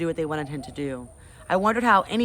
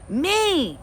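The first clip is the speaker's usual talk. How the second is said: put-on high, loud squeal of a toddler; demanding toddler; 12 semitones higher, 5 dB louder than usual. Toddler Fudge demanding his turn, high squeal. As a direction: claim it like a little brat.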